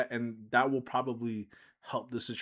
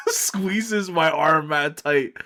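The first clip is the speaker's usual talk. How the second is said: laughing; 4 semitones higher, 7 dB louder, much slower than usual. Real, not acted. Laughing while telling.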